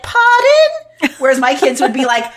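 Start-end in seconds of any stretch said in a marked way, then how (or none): 0.0-1.1 put-on high, loud, prim "Pardon?" like a well-mannered child; a mock well-mannered Australian child; polite little kid. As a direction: pipe up sweetly and properly like a tiny polite kid.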